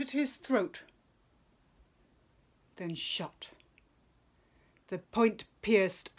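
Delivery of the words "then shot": hushed whisper. A narrator's reading. Narrator hushes at the killing shot.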